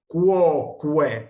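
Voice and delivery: cuoque said as kuo-kue, both U's sounded; cuoque, every U clearly pronounced; Latin pronunciation demo. Shown as a demonstration. Teacher showing students how to say cuoque, carefully. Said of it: "la pronunciamos".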